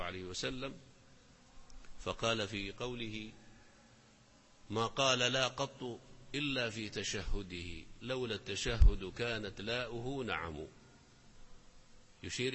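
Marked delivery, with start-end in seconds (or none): none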